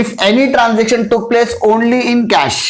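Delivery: each word spaced out slowly for note-taking; slow dictation. Shown as a demonstration. Teacher dictates the definition to students, slow and deliberate. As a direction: read it out word by word so students can write it down. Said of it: "तो देखो क्या बोल रहा हूं".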